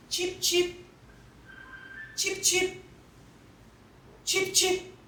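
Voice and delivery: high chirpy chip chip; high, chirpy chick voice; chick peeping. Acted. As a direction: imitate a chick. Imitating chick sound.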